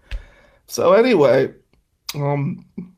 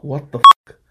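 a sudden, much louder shout of disbelief; furious shout; 13 dB louder than usual. Retold or imitated. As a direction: yell it at her, outraged. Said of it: "And I'm like".

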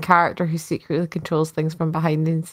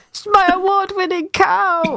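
high, wailing farmer voice; distraught farmer; 14 semitones higher than usual. Pretend. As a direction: lament the prize cow. Mock farmer lament.

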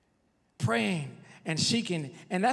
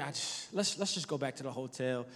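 defeated hush; 3 semitones lower, 7 dB quieter than usual. Retold or imitated. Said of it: "and I'm like".

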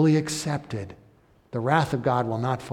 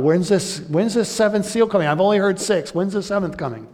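mock-anxious, higher and louder asking; impatient reader; 7 semitones higher, 6 dB louder than usual. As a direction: play the fidgety reader who can't wait. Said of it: "You're worried about".

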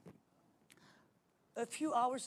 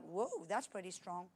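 a little shocked; 5 semitones lower, 6 dB quieter than usual. Retold or imitated. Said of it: "kind of a little shocked".

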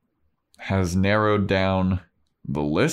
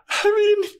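squeaky high, louder flustered mom; 5 dB louder than usual. Retold or imitated. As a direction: caught out, squeaking an excuse. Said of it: "She's like".

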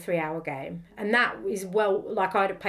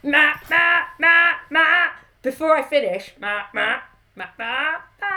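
loud nasal goose honk attempt; 7 semitones higher, 8 dB louder than usual. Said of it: "I can't do a goose noise".

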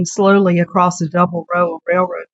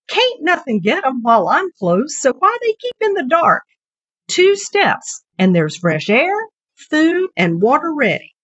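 lightly voiced old countryman, higher; a hint of a puzzled old farmer; old henkeeper; 9 semitones higher than usual. Old man puzzling over his hens to Selena, lightly voiced.

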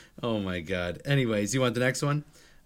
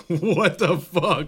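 slow, loud, drawn-out disbelief; shocked; 4 semitones higher, 5 dB louder, much slower than usual. Retold or imitated. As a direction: stretch it out, laughing. Imagined reaction, slow.

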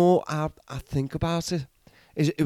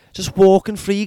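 louder, higher indignant rant; ranting shout; 5 semitones higher, 8 dB louder than usual. Acted. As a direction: rail against the free horses. Ranting about murderous horses.

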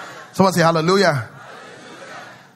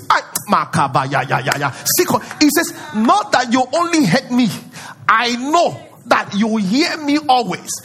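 confident prayer; 7 semitones higher, 3 dB louder than usual. Retold or imitated. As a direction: declare it with total confidence. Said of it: "See the confidence".

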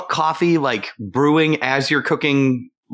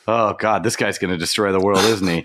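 resigned fatalist; 8 semitones lower than usual. Retold or imitated. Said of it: "they just sit around and go".

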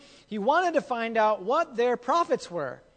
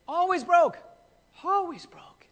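mock exclaiming; 9 semitones higher, 4 dB louder than usual. Shown as a demonstration. Mocking repeated phrase.

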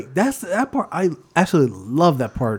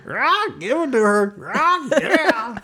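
high, drawn-out comic squawk; Gottfried voice; 10 semitones higher, slower than usual. Acted. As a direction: squawk it slowly, way up high, as a hammed-up impression. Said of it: "that Gottfried voice".

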